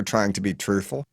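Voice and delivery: plain reading voice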